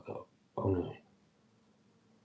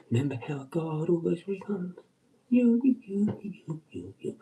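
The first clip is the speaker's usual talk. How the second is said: much higher, loud cheeky greeting; high cheeky hello to the boss; mock friendly boss greeting; 7 semitones higher, 4 dB louder than usual.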